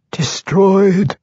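very high, watery creature voice. A narrator's reading. Mind flayer replying to the alarmed Archmage, watery voice. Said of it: "replied in his watery voice".

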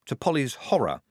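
ordinary delivery

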